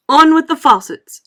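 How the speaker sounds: loud, high commanding shout; booming shout. A narrator's reading. Far voice in the cloud, booming command. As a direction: bellow the order from the sky. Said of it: "it shouted".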